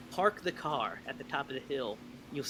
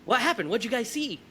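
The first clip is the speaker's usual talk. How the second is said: acted urgent questioning, high and louder; higher-pitched, agitated questions; retold alarm; 8 semitones higher, 5 dB louder than usual. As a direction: imitate demanding to know what happened. Acting out alarmed questioning of friends.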